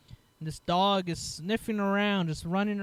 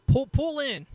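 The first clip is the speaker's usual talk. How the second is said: higher, louder mock officer command; imitated order; 9 semitones higher, 6 dB louder than usual. Retold officer directing driver into the carport, imitated order. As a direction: call it out like a checkpoint officer.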